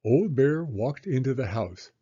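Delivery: ordinary delivery, nothing marked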